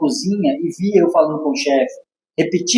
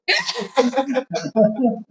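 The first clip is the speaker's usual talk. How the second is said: risada aguda; 7 semitones higher, 4 dB quieter than usual. Real, not acted. Ri da própria história.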